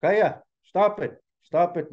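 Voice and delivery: storybook leader stepping in sternly; fed up. A narrator's reading. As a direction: call a halt, drawn out and emphatic. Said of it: "had enough of it".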